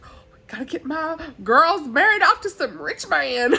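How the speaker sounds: high, hammed-up fussy mother; mock a scheming mother in a swooping high voice; high-pitched Mrs. Bennet. Vlogger imitating mother marrying off girls, high-pitched. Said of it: "her high pitch".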